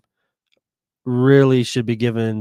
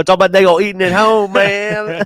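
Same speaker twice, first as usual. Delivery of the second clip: Boomhauer imitation; 7 semitones higher, 3 dB louder than usual. Friend echoes Boomhauer line.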